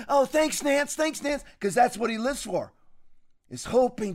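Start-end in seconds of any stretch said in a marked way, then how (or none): 0.1-1.4 higher, eager, fawning thanks. Retold or imitated. Host mocks [name] thanking [name], in a higher fawning voice. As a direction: gush the thanks like a teacher's pet.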